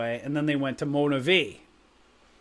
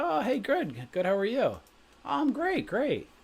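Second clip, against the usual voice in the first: high-pitched retold small talk; mock friendly greeting; 8 semitones higher, 3 dB quieter than usual. Stranger returns a greeting in small talk, mock friendly.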